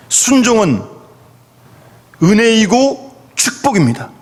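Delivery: ordinary talk, nothing marked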